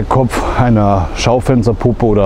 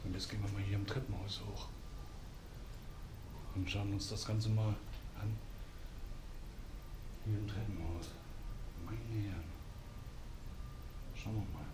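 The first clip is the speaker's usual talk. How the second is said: hushed, tense whisper while exploring; nervous night vlog whisper; 2 semitones lower, 17 dB quieter than usual. Real, not acted. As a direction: keep it whispered, wary of noise. Quiet exploring in derelict building.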